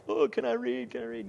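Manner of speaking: high, bright imitation of an eager small daughter; dad imitating his young daughter asking to read; eager little girl. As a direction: pipe it up like a kid begging for a turn. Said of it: "she's like".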